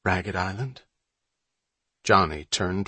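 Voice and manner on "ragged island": softly voiced, secretive question; lowered voice. A narrator's reading. Boy proposing a forbidden island to his brother, lowered voice. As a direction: drop the volume to float a forbidden idea. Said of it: "Malin lowered his voice further".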